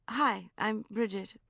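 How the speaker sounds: slow pointed greeting. A narrator's reading. Bridget greeting the boy, pointed and slow. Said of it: "I said, pointedly".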